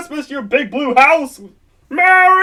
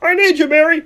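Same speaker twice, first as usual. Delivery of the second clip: ordinary talk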